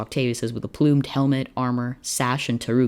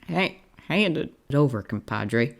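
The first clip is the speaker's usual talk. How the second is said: higher start with a small squeak, then settling; slight squeak; 3 semitones higher, slower than usual. A narrator's reading. Cowboy to doctor, asking for the stethoscope, slightly squeaky. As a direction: squeak a little, then carry on. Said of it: "squeaked slightly as he continued".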